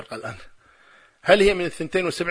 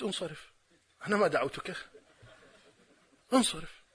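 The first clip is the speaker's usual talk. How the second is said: very soft retold send-off; quiet dismissal; 9 dB quieter than usual. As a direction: dismiss him under your voice, without raising it. Retells his curt dismissal of opponent.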